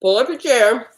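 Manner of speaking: talking while chewing a sandwich; words round a bite of food; mouth full. Real, not acted. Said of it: "didn't I ever tell you not to talk with mouth full".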